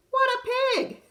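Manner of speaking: high, loud indignant cry; wolf fuming about the rude pig, indignant shout; outraged exclamation. Acted. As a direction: blurt out your outrage.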